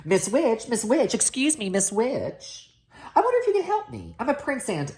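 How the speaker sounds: high, eager prince voice; polite young prince. A narrator's reading. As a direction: politely call out to the witch.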